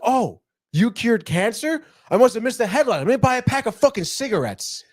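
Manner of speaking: high-pitched, sarcastic comeback; milk the fake awe, pitched well up; mock amazement. Boyfriend answers girlfriend's boast of curing cancer, sarcastic.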